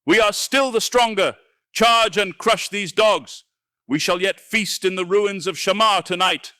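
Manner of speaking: high, loud fanatic battle cry; screaming rally. Acted. Wizard rallies wavering troops, screaming. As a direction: whip the army into a charge. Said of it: "he screamed, brandishing his lean arms madly".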